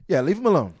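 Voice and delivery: loud, high mock warning to back off. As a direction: bark it like a friend shooing people away. Friends quoted backing off.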